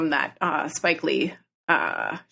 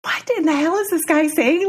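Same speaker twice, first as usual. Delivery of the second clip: astonished; 9 semitones higher than usual. Retold or imitated. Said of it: "so astonished by this that she was mute".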